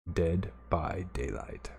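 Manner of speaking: soft, drawn-out repeat of the title; quiet echo of title. Real, not acted. Streamer repeating the game's name to himself, slow and soft.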